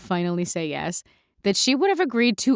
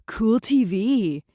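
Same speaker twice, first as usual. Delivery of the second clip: put-on dim farm boy; 2 semitones higher, much slower than usual. Oblivious farm boy remark.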